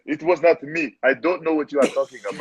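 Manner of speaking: put-on African accent. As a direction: play innocent in an African accent. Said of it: "put on my African accent".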